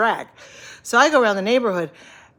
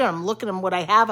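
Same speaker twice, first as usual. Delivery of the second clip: ordinary talk, nothing marked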